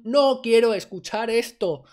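mock pupil's loud, drawn-out protest; emphatic refusal. Speaking for bored pupils made to listen, emphatic refusal. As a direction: refuse loudly, stretching the words out. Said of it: "La mayoría del déficit de atención es un".

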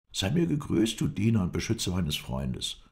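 high, eager voices trading questions; eager and animated; excited reunion. A narrator's reading. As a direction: lively pitch leaps in happy surprise. Excited reunion, rapid questions and answers.

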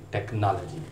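टेक्नोलॉजी as tek-NAH-luh-jee; American pronunciation. Shown as a demonstration. Teacher completing the American example, tek-NAH-luh-jee for टेक्नोलॉजी. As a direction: open "nah" in टेक्नोलॉजी, falling at the end. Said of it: "जो American pronunciation है".

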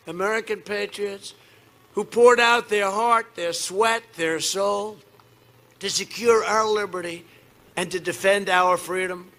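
booming campaign rally delivery; rally speech. Acted. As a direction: shout it to a packed arena.

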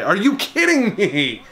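high, laughing "are you kidding me"; a real laugh of disbelief at the play. Real, not acted. Gamer laughing in disbelief at his fielder, laughing. Fielder misses an easy catch.

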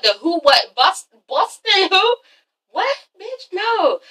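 stammering high disbelief; shocked Kyle. Retold or imitated. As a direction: sputter it out in disbelief. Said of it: "Kyle's like".

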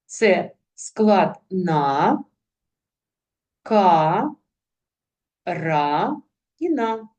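slow, syllable by syllable: на, ка, ра, на. Shown as a demonstration. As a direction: sound out each syllable slowly for children. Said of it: "знайдіть середні з'єднання".

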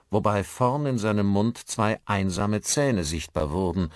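ordinary delivery, nothing marked

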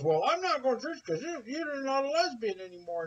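high, mocking husband voice. Husband refusing church to taunt wife, high mock whine. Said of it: "He goes".

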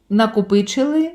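normal talking voice

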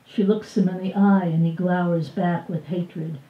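ordinary delivery

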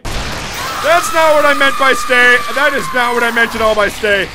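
exasperated shout. Real, not acted. Enemy gets up again.

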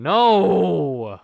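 high, very loud drawn-out no; anguished shout. Real, not acted. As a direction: cry out in despair at the bad pull. Bad pull after begging.

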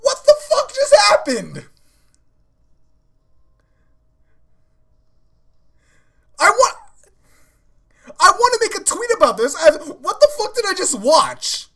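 high, loud shout of disbelief; really worked up, voice thrown up high; yelling. Real, not acted. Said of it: "I'm so sorry I'm yelling".

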